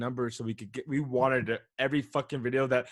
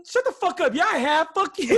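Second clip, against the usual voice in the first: much higher, louder indignant shriek; shrieking yell; 7 dB louder than usual. Retold or imitated. Kid shrieking at rabbi over phone, high loud yell. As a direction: scream back in outrage like a teen.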